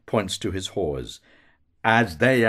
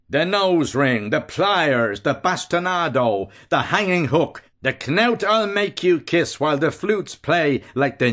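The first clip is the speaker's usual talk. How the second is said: higher, relishing savage list of tortures; 7 semitones higher, 3 dB louder than usual. A narrator's reading. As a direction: spit out each torture with cruel glee. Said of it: "Bello, savagely".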